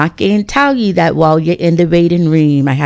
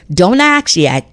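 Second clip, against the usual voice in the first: ordinary talk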